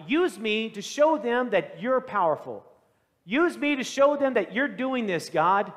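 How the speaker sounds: shouting to God. Retold or imitated. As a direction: call out to heaven with force. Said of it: "he shouts out to God".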